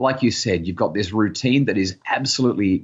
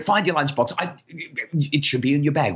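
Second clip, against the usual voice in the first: high, exasperated instructions; harried nagging parent; 6 semitones higher than usual. Acted. Parent hurrying a child to find the lunchbox, flustered. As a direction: nag in a raised pitch, hunting for things.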